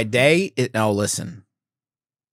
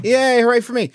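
mock cheer; 10 semitones higher than usual. Sarcastic self-cheer after fumbling.

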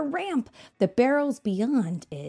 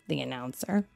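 usual speaking voice

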